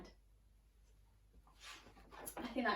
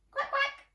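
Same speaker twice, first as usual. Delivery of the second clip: duck quack; 12 semitones higher than usual. Acted. Duck noise imitated.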